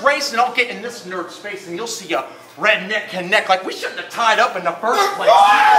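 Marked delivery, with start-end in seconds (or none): 5.3-5.7 a sudden high yelled what; shout. Real, not acted. Rapper yelling at the crowd, loud and high. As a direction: yell it out to the crowd.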